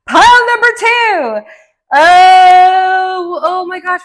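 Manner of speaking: loud, high, thrilled announcement; excited shout. Real, not acted. Reader thrilled at the second tarot pile. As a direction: shout it with delight.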